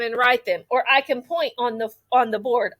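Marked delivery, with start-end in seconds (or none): none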